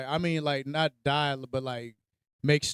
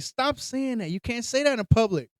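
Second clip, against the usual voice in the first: higher-pitched imitation of a scolding brother; flustered scolding; 6 semitones higher than usual. Older brother to little one in public, flustered scolding. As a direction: imitate a kid telling off his sibling.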